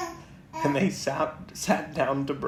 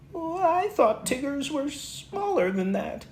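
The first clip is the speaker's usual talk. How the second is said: much higher, small timid Piglet; 12 semitones higher than usual. Acted. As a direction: squeak it like a tiny nervous pig. Piglet voiced in story.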